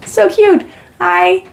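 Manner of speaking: high-pitched cooing over her dad; light up and coo at your dad watching; delighted squeal. Real, not acted. Streamer gushing at her dad joining the live chat, high and delighted.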